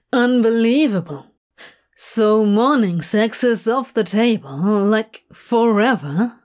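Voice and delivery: lightly voiced Crowley, a touch of playful exasperation; slightly put-on Crowley, mock outrage; incredulous grin in the voice, mildly. A narrator's reading. Crowley's voice in dialogue.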